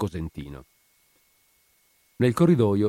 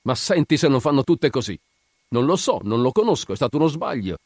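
higher, scornful mimicry; mock the excuses in a raised pitch; exasperated mockery; 6 semitones higher, 4 dB louder than usual. A narrator's reading. Guard mimicking women who deny everything, mocking. Guard mocks the victim's denials.

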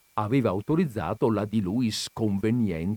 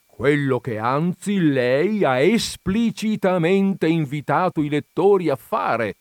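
deliberate, raised-voice rejoinder; phlegmatic; 7 semitones higher, 7 dB louder than usual. A narrator's reading. Visitor correcting the furious countess, phlegmatic. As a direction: add it unhurriedly, every word weighed. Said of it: "aggiunse l'altro con flemma".

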